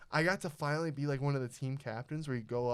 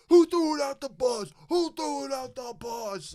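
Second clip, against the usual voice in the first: very high, loud imitation of angry coaches; coaches yelling; 13 semitones higher, 11 dB louder than usual. Coaches demanding who littered from the bus, mock furious yelling. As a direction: holler it like an outraged coach, pitched way up. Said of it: "the coaches are going".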